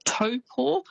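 "Taupo" said the Maori way, toe-po; correct Maori Taupo. Shown as a demonstration. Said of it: "is actually pronounced".

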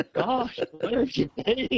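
high, incredulous teasing; teasing disbelief. Real, not acted. Caller ribbing the host about an unseen film, teasing disbelief.

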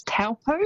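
Taupo said as TOW-po, first vowel as in 'cow'; anglicised Taupo with an 'ow' vowel; common mispronunciation. Shown as a demonstration. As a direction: mispronounce Taupo as it's spelt, TOWL-po. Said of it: "quite often you'll hear that said as".

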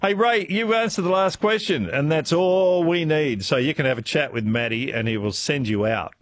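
ordinary talk, nothing marked

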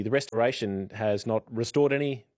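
ordinary talk

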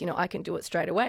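ordinary delivery

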